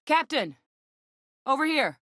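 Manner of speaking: high call to get attention; hailing someone from afar. Acted. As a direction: call across a distance, pitch way up. Calling out to the captain.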